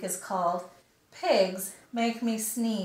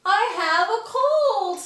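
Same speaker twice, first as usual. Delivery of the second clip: higher, louder joyful calling; excited news; 12 semitones higher, 9 dB louder, slower than usual. Acted. Gerald telling Piggy he only has a cold, excited. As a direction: burst in with happy news.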